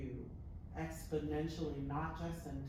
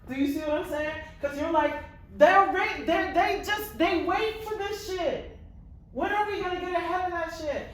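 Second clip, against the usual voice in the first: loud, high, exasperated outburst; passionate; 14 dB louder than usual. Shown as a demonstration. Coach voicing the actor's frustration to show him, loud and passionate. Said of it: "when I'm talking to y'all and I'm passionate".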